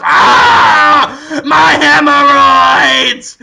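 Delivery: loud, high, drawn-out mock scream; slow, anguished comic howl; agonized cry. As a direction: wail in hammed-up pain. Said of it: "shoves them up and goes".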